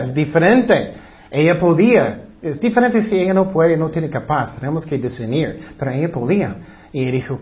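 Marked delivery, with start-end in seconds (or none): none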